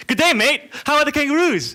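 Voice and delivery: high, loud mock-enthusiastic greeting. Strangers greeting a supposed Australian, mock-enthusiastic. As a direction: shout it brightly, imitating over-friendly strangers. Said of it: "people come and say".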